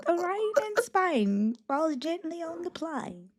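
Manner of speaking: hammed-up posh English, slow and lilting; posh British quote. Quoting My Fair Lady to friends, a slow, high, hammed-up posh English lilt. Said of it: "Is that My Fair Lady?".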